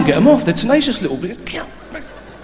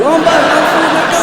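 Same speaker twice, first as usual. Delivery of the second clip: much higher, shouted darts orders with a laugh; shouting coach; 10 semitones higher, 7 dB louder than usual. Retold or imitated. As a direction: yell the moves like a frantic coach. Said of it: "midget going".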